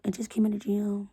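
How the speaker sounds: soft whispered mock plea; mocking husband's plea. To her late husband, whispered mocking imitation of his plea. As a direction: mimic his pleading in a hush. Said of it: "You said".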